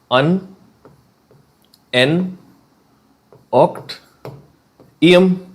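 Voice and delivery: "Un, N, oct, ium" spaced out with long pauses, "ium" rising high; syllable by syllable. Shown as a demonstration. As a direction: spell "Un, N, oct, ium" in separate beats. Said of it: "dictating the name syllable by syllable".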